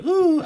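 high, sudden comic ooh; squeaked-up reflex hoot. Retold or imitated. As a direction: pop out a high jokey ooh. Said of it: "I'll just go".

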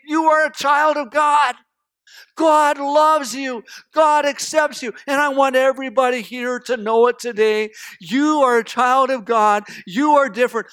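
emphatic proclamation. Acted. Preacher wants everybody here to know it, proclaimed loudly. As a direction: declare it forcefully to every listener.

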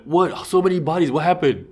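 high, alarmed questions; imitating startled players; mock shock. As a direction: act stunned at all the bodies. Players discovering many bodies.

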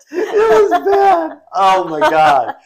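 high, slow, laughing. Real, not acted. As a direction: stretch it out while laughing. Still laughing at story.

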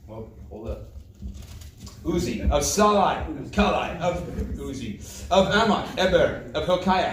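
low, measured reading of names; Scripture reading. A narrator's reading. Preacher reads the list of names to the congregation, measured Scripture reading. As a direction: read the list out solemnly, dropping at the ends.

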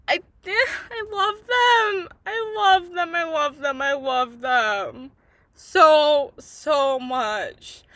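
slow, teary, fading I love them; choked-up gushing. Real, not acted. Fan overwhelmed by the couple's happy ending, teary. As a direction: let the love crack through tears.

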